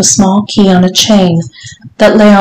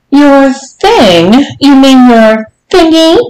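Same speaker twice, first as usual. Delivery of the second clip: high, incredulous teasing question; mocking echo; 7 semitones higher, slower than usual. A narrator's reading. Lady mocking her stammering visitor, high and teasing. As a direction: echo it high, on the edge of laughter.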